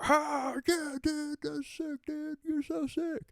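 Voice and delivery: high, hammed-up dude voice gushing; mocking bro voice. Crowd mocking him, bro voice.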